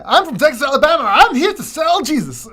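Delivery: hammed-up Southern Bible-salesman accent; mock Texas accent. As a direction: belt out a cartoonish Texas accent. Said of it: "I don't know what kind of accent he has".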